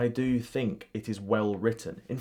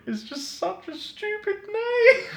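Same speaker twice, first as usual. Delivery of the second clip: very high, helpless giggly squeal; cracking up. Acted. Prisoner mocks the villain's name, cracking up. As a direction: squeal it through helpless laughter.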